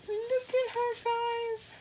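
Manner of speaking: high excited coo; high gushing squeal. Real, not acted. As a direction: squeal to the viewers in delight. Gushing over doll.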